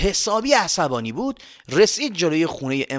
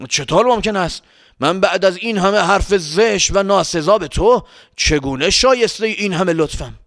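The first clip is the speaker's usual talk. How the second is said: higher, louder incredulous questions; astonished traveller; 4 semitones higher, 5 dB louder than usual. Acted. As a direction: ask in amazement, humbled by unexpected kindness.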